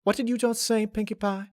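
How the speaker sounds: shocked farm pony asking; Applejack. Acted. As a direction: ask it in disbelief, breath taken away. Applejack stunned by Pinkie.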